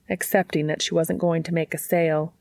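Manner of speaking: plain reading voice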